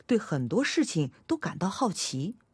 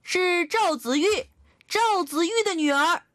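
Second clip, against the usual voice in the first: put-on all-out child's yell; 11 semitones higher, 4 dB louder than usual. A narrator's reading. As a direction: yell each syllable with all your might. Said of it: "使出吃奶的劲儿来大喊".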